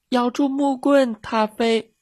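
put-on high, loud child's call; loud shout. A narrator's reading. Swimming child to the dog, shouting loudly. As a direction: shout the instruction, pitched high. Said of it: "游泳的小朋友大声喊".

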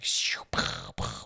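soft whoosh and popping bursts; imitated fireworks. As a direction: mimic fireworks under your breath.

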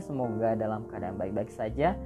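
ordinary talk, nothing marked

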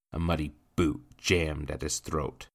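ordinary delivery

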